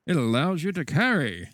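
slow, bright vintage announcer; hammed-up retro PSA pitch; chipper 1950s ad.

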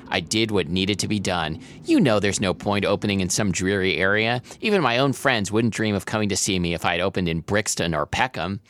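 plain reading voice

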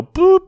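high, loud "boop" sound effect; a sudden cartoonish high boop; imitated pop. Imitates blow-up doll popping.

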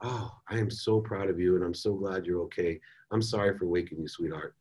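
soft, low, tender dad; loving, relieved. Retold or imitated. Relieved dad apologizing for waking his daughter, loving. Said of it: "a loving trajectory".

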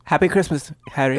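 a touch of Harry Potter English; light Hogwarts-style British greeting; mock British accent. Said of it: "start with Harry Potter".

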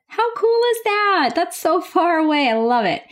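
thrilled squeal of amazement; excited. Real, not acted. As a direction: gush about far-off listeners. Excited about Japan listeners.

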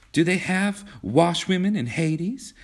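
put-on high, girlish naive inquiry; lightly wide-eyed, high-voiced curiosity; innocent question. A narrator's reading. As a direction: ask it guilelessly, pitched well up. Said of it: "asked Kismine innocently".

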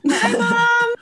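high, loud, gushing hello; bright, high-pitched shout-out to mom; excited hello. Real, not acted. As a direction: squeal a greeting to mom. Greeting mom on live show.

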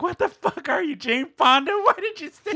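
high, loud exasperated friend; mock incredulous scolding. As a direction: scold them in disbelief, half laughing. Friend's scolding reply, acted out.

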